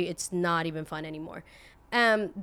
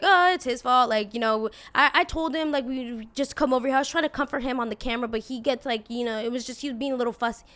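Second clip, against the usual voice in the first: loud, higher imitation of a defensive parent; exaggerated self-justifying parent; mock blame-shifting; 4 semitones higher, 6 dB louder than usual. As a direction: make excuses and push the blame away. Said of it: "basically literally just blamed the kid".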